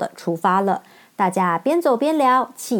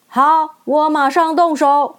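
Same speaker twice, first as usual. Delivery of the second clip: steady woodman; 6 semitones higher, 4 dB louder, slower than usual. Acted. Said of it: "woodman agrees".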